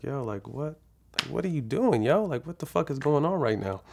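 high, incredulous questioning; replayed indignant outburst, not shouted; retold exasperated disbelief. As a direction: plead for an explanation, pitch swooping up. Retold outburst as child cries.

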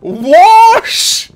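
put-on high, loud English nanny accent on Wash; hammed-up English nanny accent; mock English accent. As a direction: exclaim Wash like a posh nanny. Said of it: "That's how you guys speak".

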